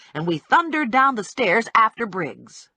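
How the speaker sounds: ordinary delivery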